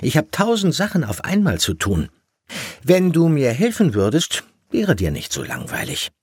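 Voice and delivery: high-pitched, exasperated mum; harried mother telling off her child; busy scolding mother. A narrator's reading. Mother figure scolds her son.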